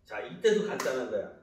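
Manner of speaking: ordinary delivery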